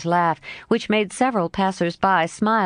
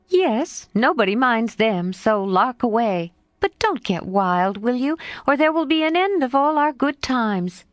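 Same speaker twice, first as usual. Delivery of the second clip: lightly voiced lively girl, a bit higher; a hint of a frank young girl, pitched up; Jo's voice; 6 semitones higher than usual. Jo answering her friend Laurie, lightly voiced girl.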